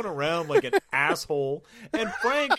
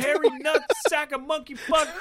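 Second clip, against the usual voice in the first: mock startled yell; 10 semitones higher than usual. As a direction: blurt it out in fake panic.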